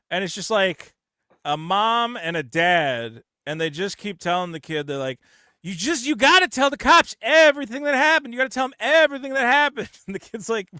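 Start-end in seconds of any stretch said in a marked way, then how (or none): 5.6-9.9 mock pleading parent. Parents pressing kid to confess.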